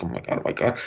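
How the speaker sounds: hushed, whispered dismay; hand-over-mouth shock. Retold or imitated. Visitor appalled at the sculpture, whispering in shock. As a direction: whisper it behind your hand. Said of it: "I put my hand on my mouth".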